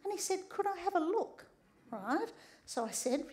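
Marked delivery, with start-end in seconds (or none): 0.5-1.5 put-on higher small boy asking; lightly voiced little boy; a hint of an eager child; child's voice. Child's question retold.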